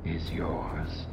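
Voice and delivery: soft, low, menacing murmur; low whispering. Acted. The Count warning the women away, low and hushed. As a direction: hush it like a secret threat. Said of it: "heard a whispering at my door".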